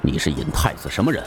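low, quick questioning; stern emperor. A narrator's reading. Emperor questions the mad intruder, low and stern. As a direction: demand it low and fast, with controlled authority.

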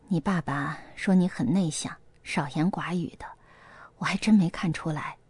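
lightly voiced aunt. A narrator's reading. Father's girlfriend answering his daughter, lightly voiced, low and soft.

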